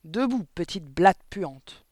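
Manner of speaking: loud, slow, scornful command; furious headmistress; angry barked order. A narrator's reading. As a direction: bark the insult, stretching it out. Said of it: "aboya-t-elle".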